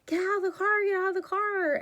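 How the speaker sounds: high, loud frantic yell down the phone; retold scream. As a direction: yell at him to get out, panicking. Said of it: "she's screaming at him on the phone".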